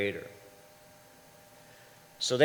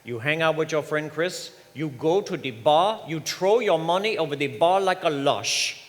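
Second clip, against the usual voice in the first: put-on mother's Caribbean island accent, higher and louder; 5 semitones higher, 5 dB louder than usual. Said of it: "Caribbean woman with an island accent".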